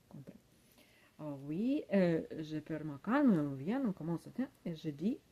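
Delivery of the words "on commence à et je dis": ordinary talk, nothing marked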